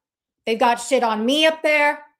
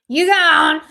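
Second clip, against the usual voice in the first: loud, high mocking call; playful shout; 6 semitones higher, 8 dB louder than usual. Streamer teasing someone who got banned, playful shout.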